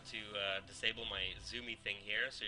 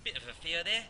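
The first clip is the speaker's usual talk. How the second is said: put-on higher-pitched, jokey Australian accent; 7 semitones higher than usual. Caster joking about his bad accents, in a mock Australian accent. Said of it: "I'm doing my, my Australian accent".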